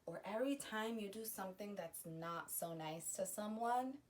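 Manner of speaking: ordinary talk, nothing marked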